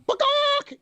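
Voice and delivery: very high squawked "Bakak"; chicken squawk. Retold or imitated. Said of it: "this chicken flying across the air going".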